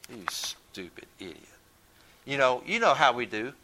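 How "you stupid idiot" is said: sarcastic mutter under the breath; a low sarcastic aside, barely said aloud; under his breath. Retold or imitated. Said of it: "he said it under his breath".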